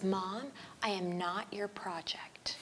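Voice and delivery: slow whispered retort, imitating her daughter; whispered daughter quote. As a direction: whisper the daughter's line slowly. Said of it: "And she goes".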